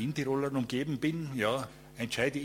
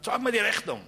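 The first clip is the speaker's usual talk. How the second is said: loud, higher barked order; angry demand; 10 semitones higher, 5 dB louder than usual. Retold or imitated. Owner demands to see the receipt, angry shout. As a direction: bark the demand. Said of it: "Sag er".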